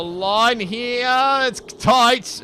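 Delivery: excited race call. Real, not acted. Close fight to the line.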